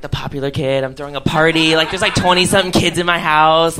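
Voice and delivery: ordinary talk, nothing marked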